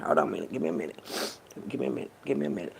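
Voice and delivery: low, choked plea for a moment; fighting off real tears, voice dropped low; holding back tears. Real, not acted. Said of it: "I got a little tears in my eyes".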